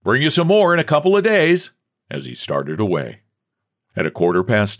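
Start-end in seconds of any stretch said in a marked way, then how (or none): 0.0-1.7 high-pitched parting call; called-out shout. A narrator's reading. Jasper calling back as he starts away, shouting. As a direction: shout it back as you leave. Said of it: "He merely shouted".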